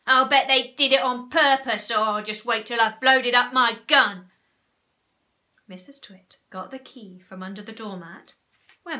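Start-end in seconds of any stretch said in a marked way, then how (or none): 0.1-4.3 loud, threatening rant; outraged character shouting; angry yell. A narrator's reading. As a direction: rant loudly as a vengeful Mr Twit. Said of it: "yelled Mister Twit".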